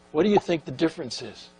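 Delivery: usual speaking voice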